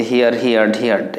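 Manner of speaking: "হিয়ার্ড" as hee-ard, like "hear" plus d; common mispronunciation. Shown as a demonstration. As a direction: say "হিয়ার্ড" with the ee-a glide kept. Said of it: "এরকমভাবে উচ্চারণ করি".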